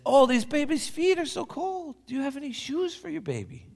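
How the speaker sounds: very high, concerned fuss. Acted. Papa fussing over the baby's cold feet, high and concerned.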